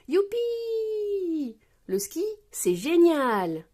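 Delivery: high, long-drawn whoop of joy; excited yell. A narrator's reading. Peppa skiing downhill, excited yell. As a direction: cry out high, then stretch it gleefully. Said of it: "hurle Peppa".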